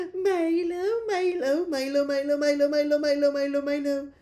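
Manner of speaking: high, sing-song calling of the name. Real, not acted. Calling the dog by name to make him smile, high sing-song. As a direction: call the dog's name high and playful. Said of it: "he loves hearing his own name".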